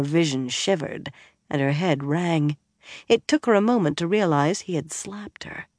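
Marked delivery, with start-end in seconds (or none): none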